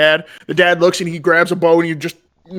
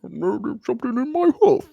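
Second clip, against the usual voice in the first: higher, different voice for the talking yak; 7 semitones higher than usual. Acted. Yak complaining to the paladin, lightly voiced animal. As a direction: give the yak a sulky voice. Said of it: "You just see the yak go".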